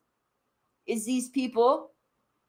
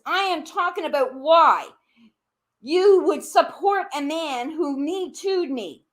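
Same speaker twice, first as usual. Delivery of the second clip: ordinary talk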